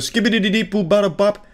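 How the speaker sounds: high, quick scat gibberish; imitated high-pitched scat burst; mock scat. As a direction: rattle off nonsense syllables like a scat singer. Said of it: "just did a full scat".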